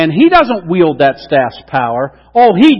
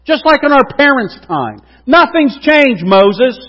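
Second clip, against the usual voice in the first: exasperated cry; 7 semitones higher, 5 dB louder, slower than usual. Acted. Moses voiced scolding God's people.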